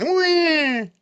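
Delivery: high fake crybaby wah; mock baby wail. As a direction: squeal a pretend baby cry. Mocking the guest as crybaby.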